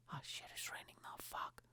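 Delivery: whispered dismayed swearing; hushed aside. Real, not acted. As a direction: mutter it under your breath, very soft. Dismayed aside as rain starts.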